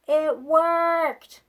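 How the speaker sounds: loud happy exclamation; delighted cheer. Acted. Gerald celebrating with Piggy, delighted cheer. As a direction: cheer in relief.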